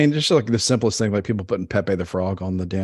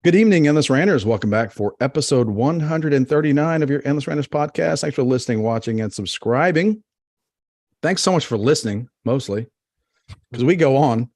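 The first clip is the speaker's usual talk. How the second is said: podcast host intro; 4 semitones higher than usual. Acted. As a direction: welcome the listeners like a host.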